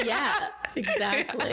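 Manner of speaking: agreeing through laughter; laughing. Real, not acted. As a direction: laugh while saying yes.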